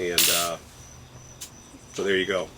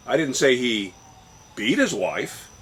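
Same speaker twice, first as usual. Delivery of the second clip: pause, then "beat" pitched up and emphasized; stress on BEAT. Shown as a demonstration. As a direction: hold back, then lean on "beat". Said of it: "depending upon where you put the emphasis".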